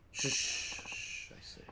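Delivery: hissed, voiceless shushing; drunken hushing. Acted. Drinker cuts off his buddy's objection, hushing. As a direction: hush them in a loose whisper.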